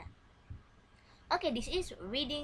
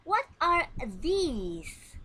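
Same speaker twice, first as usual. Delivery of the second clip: drawn-out question, higher and louder; very slow model question; 6 semitones higher, 6 dB louder, much slower than usual. Shown as a demonstration. Teacher drilling a plural question, very slow and loud. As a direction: stretch every word so pupils can copy. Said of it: "let's try this dialogue".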